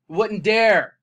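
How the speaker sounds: loud, scornful punch; angry. Real, not acted. Vlogger scorning critics, loud scornful jab. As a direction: hit it with contempt. Angry rant about sheriff.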